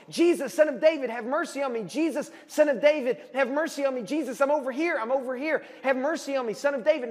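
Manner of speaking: high, urgent repeated calling out; acted yelling. Blind man trying to get Jesus' attention, acted yelling. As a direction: call over a crowd to be noticed, half-shouted. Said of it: "get attention is start yelling".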